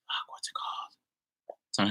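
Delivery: slow, voiceless whisper while trying to remember; whispered to self. Real, not acted. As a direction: whisper it under your breath, searching for the name. Searching memory, talking to self.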